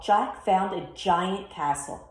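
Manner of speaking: ordinary delivery, nothing marked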